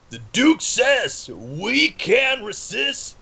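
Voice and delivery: high, slow, drawn-out proclamation; in-character shout. Acted. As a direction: proclaim it to a crowd, swooping high. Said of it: "and I shout".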